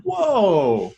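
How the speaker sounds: a high, drawn-out whoa of surprise; mock amazed whoa. To a co-host, retelling watching the film: high amazed whoa. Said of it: "I was like".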